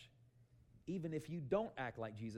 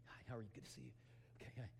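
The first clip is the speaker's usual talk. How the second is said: hushed, polite little greetings; quiet and polite; 4 semitones lower, 12 dB quieter, faster than usual. Retold or imitated. Husband politely greeting shoppers while searching for his wife, hushed. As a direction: greet passers-by softly, trying not to draw attention. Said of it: "we're trying to be quiet, polite".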